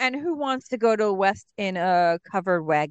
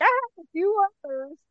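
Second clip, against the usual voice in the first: quoting Nate; 9 semitones higher than usual. Retold or imitated. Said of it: "And then he's like".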